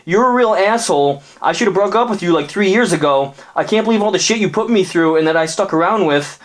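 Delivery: put-on fed-up girlfriend. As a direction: list every complaint from up high. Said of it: "Cyclops looked back and said".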